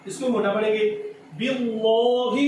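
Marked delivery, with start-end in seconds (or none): 1.4-2.4 very slow "باللہ" as "billoh", heavy lam; wrongly thickened lam. Shown as a demonstration. Teacher testing children with a mistake, thick lam. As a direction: wrongly darken and stretch "باللہ". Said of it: "غلط پڑھا ہے".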